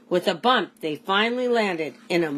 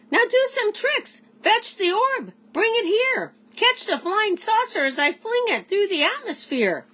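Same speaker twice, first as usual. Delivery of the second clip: alien Jopner. A narrator's reading. Jopner commands his pet.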